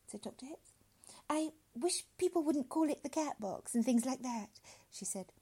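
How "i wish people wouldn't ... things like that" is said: high, lilting woman's voice; politely insistent, pitched up; objecting woman. Acted. Woman repeats objection for doctor, primly insistent.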